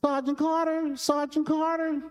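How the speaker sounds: put-on high child's holler, slowing on the second call; a kid calling out from the closet; high, pleading boyish call. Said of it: "He started hollering".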